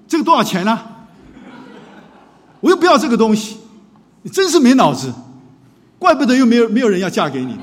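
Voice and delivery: loud, high furious scolding; harsh father berating his son over a gift; angry father. Retold or imitated. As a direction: yell it at your son like he's worthless.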